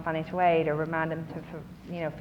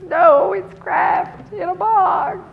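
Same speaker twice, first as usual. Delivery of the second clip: much higher, slow, loud wailing sob voice; imitating sobbing; 12 semitones higher, 10 dB louder, much slower than usual. Imitating the crying student's answer, high slow sobbing wail. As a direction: wail it out like a weepy freshman. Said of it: "And she's sobbing".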